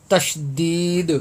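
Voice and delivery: تشدید drawn out very slowly, syllable by syllable; slow drawn-out term. Shown as a demonstration. Teacher announcing the lesson title, slowly drawn out. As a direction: stretch out تشدید for the students. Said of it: "ہم نورانی قاعدے کی بارہ number تختی".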